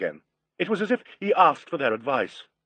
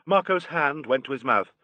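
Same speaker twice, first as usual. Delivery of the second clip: plain reading voice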